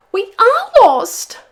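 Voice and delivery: high, loud, drawn-out wail; despairing wail. A narrator's reading. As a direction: wail it slowly in panic. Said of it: "wailed Pog".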